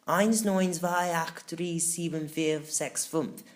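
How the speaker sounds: slow, odd little crab counting in German. Acted. Little crab calling out the code, slow and odd. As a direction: count each number slowly like a tiny crab. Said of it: "the little crab repeated".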